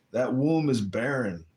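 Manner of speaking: normal talking voice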